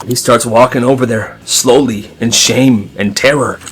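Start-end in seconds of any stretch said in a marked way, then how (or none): none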